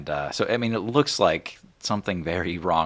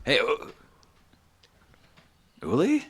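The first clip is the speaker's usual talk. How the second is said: higher, halting, questioning call; surprised recognition; 9 semitones higher, 4 dB louder than usual. Acted. Brother spotting Uli unexpectedly, surprised recognition. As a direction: break off, then call the name in disbelief.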